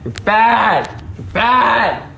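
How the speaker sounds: high-pitched scolding, like to a naughty pet; playful scolding. Pretend. Podcaster telling off a bad Pooh Bear, playful scolding. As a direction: tell it off in mock outrage.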